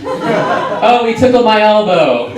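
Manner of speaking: high, loud, drawn-out mock whine; mock complaining. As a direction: wail it like a griping soccer player. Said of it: "'cause they complain too much".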